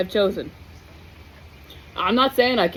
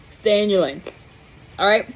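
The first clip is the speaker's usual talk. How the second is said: talking while crunching a cough drop. Real, not acted. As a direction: speak around a crunching lozenge. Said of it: "Sorry, I'm crunching my cough drop now".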